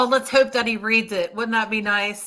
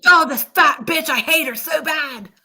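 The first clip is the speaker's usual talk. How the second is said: louder, higher hammed-up rant; mock furious yell breaking into laughter; mock outburst; 7 semitones higher, 3 dB louder than usual. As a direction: imitate someone flipping out, half laughing. Voices her flipping out at them.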